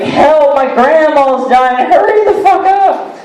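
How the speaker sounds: high, frantic plea climbing to the end; hammed-up desperate cry for help; mock panic. Comic acting out a caller with a dying grandma, mock panicked. Acted emergency call for ambulance.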